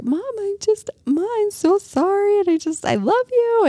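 put-on high, pleading little girl's apology; tearful daughter. Daughter running to her mom, high tearful plea. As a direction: beg forgiveness like a crying child.

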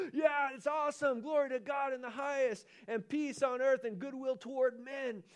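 high, eager chorus of praise; excited angels. Acted. Angels backing him up, a high excited chorus. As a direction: join in like a thrilled choir.

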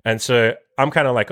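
usual speaking voice

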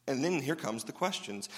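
ordinary delivery

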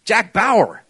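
ordinary delivery

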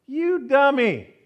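loud, high, drawn-out mock insult; mock scolding. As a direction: scold a driver slowly and loudly for laughs. Acts out scolding another driver.

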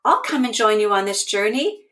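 bright offer from the kind soul, rushing; eager friend. A narrator's reading.

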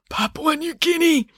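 high, strained burst from a wounded professor; urgent interruption. Acted. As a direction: cut in with the answer. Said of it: "interrupted Maynard".

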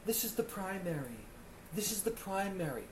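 a hint of a TV realtor showing the bedroom; mock realtor. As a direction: purr it like a showy realtor. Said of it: "they walk into the bedroom".

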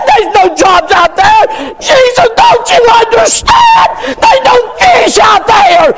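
high, shouted half-laughing protest; mock desperate man. Preacher mocking a man excusing missed church, high shout. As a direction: wail the excuse like a frantic provider.

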